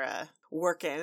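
ordinary delivery

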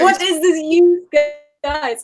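high, playful mock disbelief; mock bafflement. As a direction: puzzle over the foreign phrase in play. Guest mock-baffled by New York plural.

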